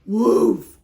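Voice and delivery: loud barking woof; dog woof. Acted. Dog answers Duck with a loud woof. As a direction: bark it like a big dog. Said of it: "we have to use the voices".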